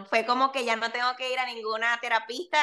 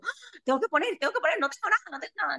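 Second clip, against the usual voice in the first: mock panic; 7 semitones higher, faster than usual. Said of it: "antes me daba como".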